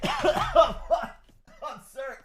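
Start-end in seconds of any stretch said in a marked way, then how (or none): 0.0-0.8 choking cough with a strangled laugh; sputtering cough, pitch jumping up; real coughing fit. Real, not acted. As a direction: cough hard on a wrong swallow. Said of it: "coffee went down the wrong way".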